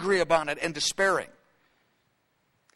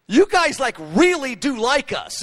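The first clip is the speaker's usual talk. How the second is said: higher, louder, marvelling; surprised delight; 5 semitones higher, 4 dB louder, slower than usual. Retold or imitated. Knesset spokesman marvelling that Christians like them, excited. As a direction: exclaim it in wonder. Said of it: "But he goes".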